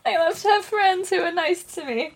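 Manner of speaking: high, sugary fake cheer; mock cheerful. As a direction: pretend everything is lovely.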